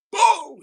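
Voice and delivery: loud, high-pitched "boom"; sudden exclaimed sound effect for a drop; big storytelling boom; dramatic boom. Acted. Sound of bag dropped in story.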